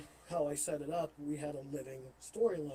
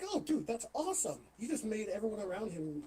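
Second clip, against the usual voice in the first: higher-pitched, excited imitation of a client; impressed reaction; 6 semitones higher than usual. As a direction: mimic someone thrilled by the news. Voicing the impressed contract holder.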